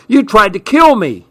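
high, outraged cry; screeched. A narrator's reading. Man hit by ice accusing Gunny, screeched. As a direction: screech it in indignation, pitch jumping up. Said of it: "he screeched".

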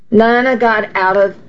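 ordinary delivery

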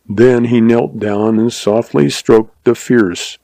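ordinary delivery, nothing marked

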